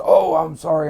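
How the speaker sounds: high, loud flustered apology; mock a flustered old man backing down; Sal apologising. Sal apologising after approaching the wrong person, hammed-up apology. Sal's retold apology for his mistake.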